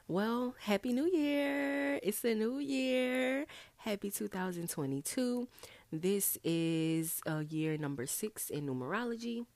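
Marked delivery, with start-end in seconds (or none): 0.1-5.5 high, drawn-out cheer; celebratory greeting. Real, not acted. Host greeting listeners for New Year, high celebratory cheer. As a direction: sing out the greeting in long, rising vowels.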